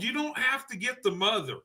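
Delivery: ordinary delivery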